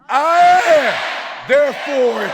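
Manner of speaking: high, loud, shouted I am; shouted declaration. Shown as a demonstration. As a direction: shout it like you mean it. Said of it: "say it like you mean it".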